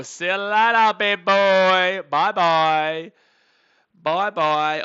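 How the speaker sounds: real taunt at the batter; taunting shout. Real, not acted. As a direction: jeer the batter off loudly.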